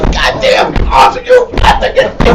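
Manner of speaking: high, strained mock rage at the altar. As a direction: shriek at the altar like a frantic prophet. Prophets stomping the altar.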